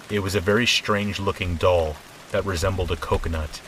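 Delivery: plain reading voice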